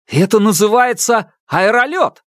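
indignant shout. Acted. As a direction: insist on the name. Inventor shouts back.

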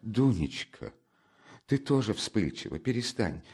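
soft, anxious mother's pleading; frightened. Acted. Frightened mother rushing to calm her daughter, anxiously. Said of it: "перепугалась мать".

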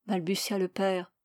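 usual speaking voice

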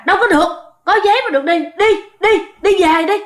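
fast, high mock shout of a judge; mock yell. Quan tòa quát đuổi người chồng về, giọng cao, nhanh. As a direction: bark the refusal fast and high. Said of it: "ông mới la".

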